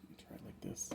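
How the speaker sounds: barely voiced, under the breath; whisper. Real, not acted. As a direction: murmur it to yourself. Quietly testing mic before starting.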